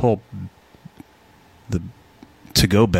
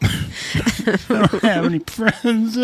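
laughing through the words, slow and high; a real laugh breaking into the line; 11 semitones higher, much slower than usual. Real, not acted. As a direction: chuckle it out at your own expense. Laughing at his own joke.

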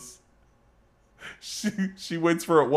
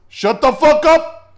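high, loud, slow yelled retort; furious shout; 11 semitones higher, 10 dB louder than usual. Retold or imitated. Drunk neighbor yelling back up at the wife, high furious shout. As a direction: bellow it back at her.

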